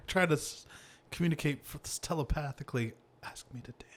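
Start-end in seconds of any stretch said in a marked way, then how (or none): 3.2-3.9 breathy silent whisper, barely there. Pretend. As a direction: mouth it like a secret wish sent across the room. Imagined telepathic plea to him.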